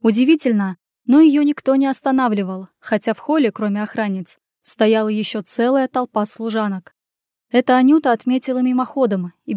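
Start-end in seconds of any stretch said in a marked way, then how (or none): none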